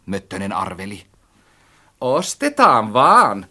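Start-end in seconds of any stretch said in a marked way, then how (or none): 2.0-3.5 slow, loud, higher-pitched agreement; ready agreement. A narrator's reading. Narrator to friend about buying, agreeing readily. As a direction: draw out the instant consent. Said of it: "minä myönnyin heti".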